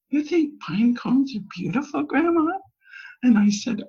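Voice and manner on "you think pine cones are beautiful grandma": put-on higher incredulous kid asking; lightly voiced skeptical child; doubtful child. Grandkids doubting grandma's taste, higher incredulous voice. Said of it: "they looked at me like".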